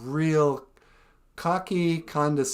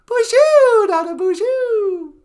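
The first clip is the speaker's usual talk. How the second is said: Nana Bonjour, much higher, thick French accent; a squeaky old lady with a heavy French accent; high, slow French-accented granny; 12 dB louder, slower than usual. Shown as a demonstration. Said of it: "just me, you know, with a thicker accent".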